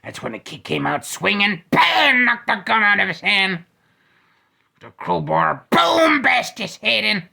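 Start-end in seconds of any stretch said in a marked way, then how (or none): 1.7-2.2 shouted bam. Acted. Hit sound effect. 5.7-6.2 shouted boom. Acted. Hit sound effect.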